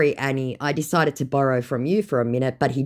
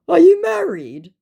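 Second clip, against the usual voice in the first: mock scammer; 9 dB louder than usual. Scammer's question, acted.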